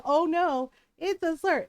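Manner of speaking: mock dismay. Mimicking people who object to the term, mock dismay. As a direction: imitate a fussy complainer's cry of distress. Mimics complainers' distress, then rebuts.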